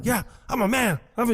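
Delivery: high-pitched mock boast; mocking mimicry. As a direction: parody his big-man swagger in a silly high voice. Mimicked boast within acted quarrel.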